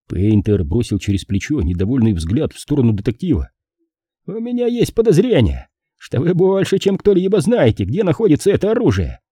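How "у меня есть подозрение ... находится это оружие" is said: high-pitched, pointed accusation; suspicious policeman. A narrator's reading. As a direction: accuse the detective in a raised, insistent voice. Policeman accuses detective in story. (подозрительный полицейский, высокий голос)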